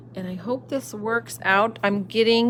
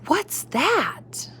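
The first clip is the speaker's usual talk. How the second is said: put-on amazed child; 9 semitones higher than usual.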